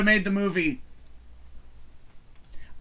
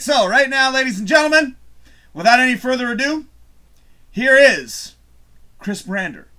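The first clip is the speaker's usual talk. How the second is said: loud, high showman intro; announcer voice; 7 semitones higher, 9 dB louder than usual. Acted. Man announcing his video to viewers like a showman. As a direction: present it like a ringmaster.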